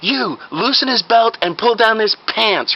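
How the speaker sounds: high, slow, deliberate order; commanding fake doctor. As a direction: order a bystander with mock urgency.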